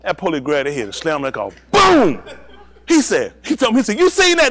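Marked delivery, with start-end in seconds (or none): 1.7-2.2 high, loud boom; imitated slam. Police slam a head on the car, loud boom. 4.0-4.5 high, loud, outraged question; urgent appeal. Retold or imitated. Beaten man calls on the comic as witness, urgent appeal.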